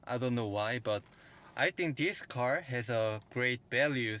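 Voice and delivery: ordinary talk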